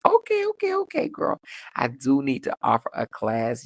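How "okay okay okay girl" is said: real pleased squeal; delighted. Real, not acted. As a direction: hype up the commenter. Reacting to compliment.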